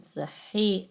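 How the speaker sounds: ordinary talk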